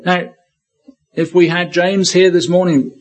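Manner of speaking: ordinary talk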